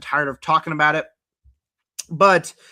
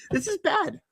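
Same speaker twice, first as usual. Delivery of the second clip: high laugh spilling into the words; real laugh. Real, not acted. Vlogger laughing at his own cracked voice, real laugh. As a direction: laugh it off, amused at himself.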